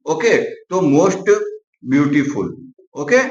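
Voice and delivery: ordinary talk, nothing marked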